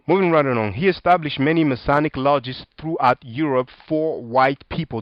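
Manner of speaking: ordinary delivery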